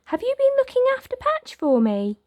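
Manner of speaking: put-on bright little girl introducing herself. Girl greeting Oliver with a smile in a little girl voice.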